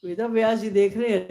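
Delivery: ordinary delivery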